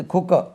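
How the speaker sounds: "குக்கர்" as khu-ka with silent r; correct pronunciation. Shown as a demonstration. Teacher modelling the English word, "குக்கர்" as khu-ka. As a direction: say "குக்கர்" short, aspirated k, no r. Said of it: "குக்கர் சொல்லக்கூடாது".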